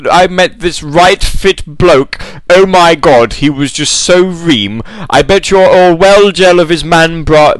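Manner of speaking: posh drawl mangling Essex slang; Chelsea toff. Acted. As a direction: play a mock toff trying out street slang. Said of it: "someone from Made in Chelsea ended up on Towie".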